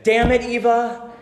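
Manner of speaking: retold outburst, high and loud; frustrated shout. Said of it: "that I shouted at you".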